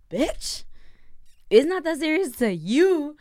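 high sharp comeback; snapping back. Retold or imitated. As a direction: read them for filth. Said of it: "I wanted to just be like".

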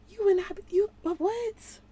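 you, but what? in shocked disbelief; incredulous outburst. Acted. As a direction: shriek it in disbelief. Outraged at being wronged.

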